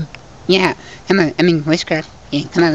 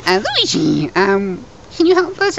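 high squeaky voice; 12 semitones higher than usual. Acted. Squeaky character voice.

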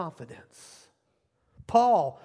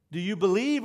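normal talking voice

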